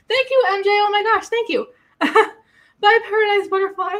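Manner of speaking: high-pitched, delighted gushing; excited thanks. Real, not acted. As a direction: gush thanks to viewers, laughing. Streamer thanks viewers in live chat.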